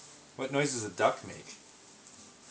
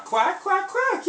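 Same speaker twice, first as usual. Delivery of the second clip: high quacking; 7 dB louder, slower than usual. Shown as a demonstration. Demonstrating duck sound to toddler.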